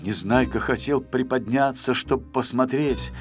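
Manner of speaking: plain reading voice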